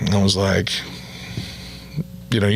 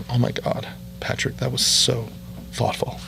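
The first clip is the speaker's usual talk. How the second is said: gushing whisper; 6 dB quieter than usual. Retold or imitated.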